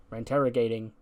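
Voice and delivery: ordinary delivery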